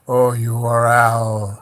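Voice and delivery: lordly and slow, savouring the name; slow imposing voice of a grotesque queen; Madame's voice. Retold or imitated. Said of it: "and she goes".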